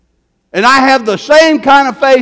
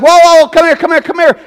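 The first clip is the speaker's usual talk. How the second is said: loud, higher call to stop her; 7 semitones higher, 4 dB louder, faster than usual. Retold or imitated. Preacher calling a woman back across the store, loud and high. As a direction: call her back before she walks off.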